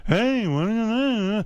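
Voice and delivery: high, slow Seinfeld try; a weak stab at Seinfeld; bad Seinfeld impression. Host doing his weak Seinfeld impression, high and slow. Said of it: "I do... doesn't really sound like Seinfeld".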